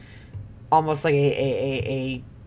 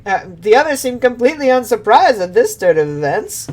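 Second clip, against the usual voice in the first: ordinary talk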